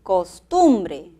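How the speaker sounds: "Costumbre" slowly, weight on TUM; teacher's careful "Costumbre", middle syllable stressed; second-syllable stress. Shown as a demonstration. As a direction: sound out "Costumbre" as cos-TUM-bre. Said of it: "en la segunda".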